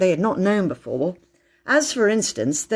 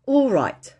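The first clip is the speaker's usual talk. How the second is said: higher, brisk agreement; agreeing; 5 semitones higher than usual. A narrator's reading. Peter agreeing to unsay everything, conceding. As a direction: accept the truce, pitched up.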